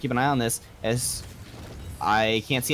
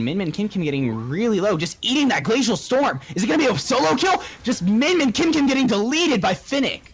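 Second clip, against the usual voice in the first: much higher and louder, near a shout; excited play-by-play; 9 semitones higher, 5 dB louder than usual. Real, not acted. Caster calling a mid lane solo kill, shouting with excitement. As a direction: hype the solo kill as it happens.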